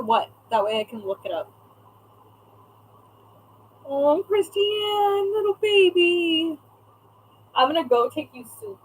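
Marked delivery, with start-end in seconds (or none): 3.9-6.6 higher, very slow sympathetic coo; drawn-out pity for a sick friend; tender cooing. Real, not acted. Streamer to sick friend Christiane, slow tender coo.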